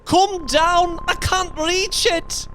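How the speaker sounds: high, strained calling; loud cry. A narrator's reading. Wife calling her husband down to the bolt, loud strained cry. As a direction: call for help loudly, pitched very high. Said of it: "she cried loudly".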